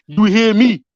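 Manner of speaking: put-on loud Baltimore street accent. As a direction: hit it hard in a Baltimore accent. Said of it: "my Baltimore accent".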